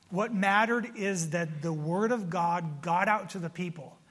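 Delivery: ordinary talk, nothing marked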